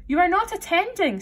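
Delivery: high, loud scolding; severe. A narrator's reading.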